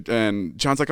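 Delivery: ordinary delivery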